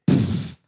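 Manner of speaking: sharp, loud puff of dismissal; scoffing pfft. Retold or imitated. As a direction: blow a scornful raspberry of air. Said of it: "That's what he did".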